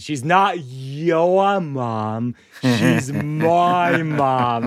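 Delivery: put-on very slow toddler Bostonian; Boston accent. Comic voices his daughter correcting him, drawled Boston accent. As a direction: stretch the vowels like a Boston toddler. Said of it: "all toddlers have a Boston accent".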